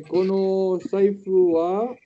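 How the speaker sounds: Kono saifu wa stretched out very slowly; very slow repeat. Shown as a demonstration. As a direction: drag out Kono saifu wa, testing the word.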